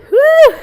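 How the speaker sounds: high loud whoop of effort; cheer. Real, not acted. Fitness coach cheering after a tough set. Cheer during bicycle circles.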